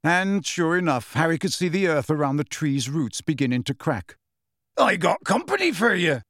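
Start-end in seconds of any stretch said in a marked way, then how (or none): 4.8-6.2 higher, coaxing call up to the giant; Hagrid shouting. A narrator's reading. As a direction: sing it out up to a huge friend. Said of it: "Hagrid shouted".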